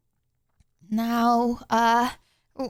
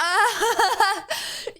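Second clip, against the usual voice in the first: laughing; 6 semitones higher than usual. Real, not acted. Streamer laughs at chat request.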